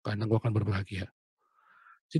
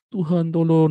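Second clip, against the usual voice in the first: a loud mock cry for help to God; a frightened man crying out to God, mock retelling; retold crying prayer; 7 semitones higher, 10 dB louder than usual. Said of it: "nangis-nangis saya sampai situ".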